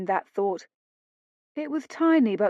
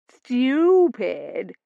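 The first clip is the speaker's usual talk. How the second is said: Stupid with rising, higher, ladylike lilt; her posh accent; 4 semitones higher, 5 dB louder than usual. Shown as a demonstration. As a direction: copy her refined Stupid. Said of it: "Only say it the way you did".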